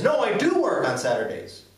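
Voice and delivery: insist, with pitch jumping on "do"; emphatic do. Shown as a demonstration. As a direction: correct them, punching the word "do". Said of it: "you wanna make an emphasis".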